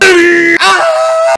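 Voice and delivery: high, loud sudden cry; retold scream of pain. Vlogger reliving waking up with a cramp, sudden loud high cry. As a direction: scream like a cramp just woke you.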